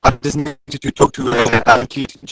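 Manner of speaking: ordinary talk, nothing marked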